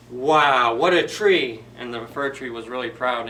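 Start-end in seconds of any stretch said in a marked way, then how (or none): 0.1-1.6 loud wondering wow; amazed. Acted. Visitors admiring the tree, loud and amazed. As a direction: gasp at the sight of it. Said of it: "they're like".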